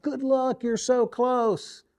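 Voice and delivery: high, excited fan calling good luck; imitating a fan's high, eager cheer. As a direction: shout encouragement like an eager fan from the rail. Fan cheering over the rail.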